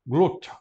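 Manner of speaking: "Glocha" pronounced GLOT-cha; "Glocha" with affricate tch, stressed first syllable; affricate variant. Shown as a demonstration. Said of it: "Ou glocha".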